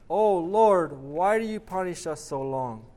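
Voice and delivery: loud narrated cry, starting high; storyteller's shouted plea to God; shouting with might. A narrator's reading. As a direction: call out in desperate appeal, easing off. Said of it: "suddenly shouts with all his might".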